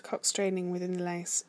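usual speaking voice

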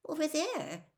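high, timid answer; shaky. A narrator's reading. Hermione points to where the voice was heard, shaky. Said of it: "said Hermione shakily".

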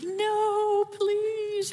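high, pleading "No, please"; mock pleading introvert. Wife imitating herself begging her husband not to invite guests, high pleading. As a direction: beg off the guests in a high put-on voice. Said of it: "it's like".

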